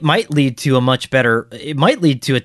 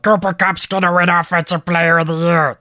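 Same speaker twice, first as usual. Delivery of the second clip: higher, louder put-on cartoon voice; quick character voice; 7 semitones higher, 4 dB louder than usual. Shown as a demonstration. Trying a Muppet voice on his cohost, higher and louder. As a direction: blurt it out in a puppet voice. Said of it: "That was pretty good, though".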